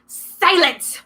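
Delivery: high, sharp snarl. A narrator's reading. Monster snarling at the hidden girl, sharp. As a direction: cut the girl off with venom. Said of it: "Medusa snarled".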